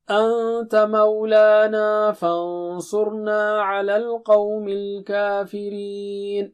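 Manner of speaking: drawn-out melodic tajweed recitation, each phrase held long; slow Quran recitation. Acted. Teacher reciting a verse to students, slow melodic tajweed. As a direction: chant the verse slowly with pauses between phrases.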